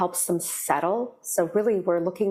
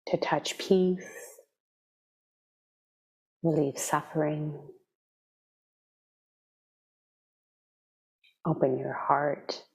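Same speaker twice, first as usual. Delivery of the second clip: guided meditation. Real, not acted. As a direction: ease them gently into stillness, leaving space between phrases.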